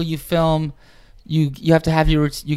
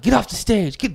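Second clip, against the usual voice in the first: retold yelling; 8 semitones higher than usual. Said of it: "they're yelling at us".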